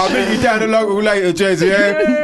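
lightly voiced cockney mate inviting Jez to the pub; cockney geezer. Answering listener as cockney mate.

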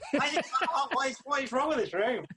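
high, puzzled question; confused sweaty punter looking around; bewildered audience. Retold or imitated. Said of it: "looking around like".

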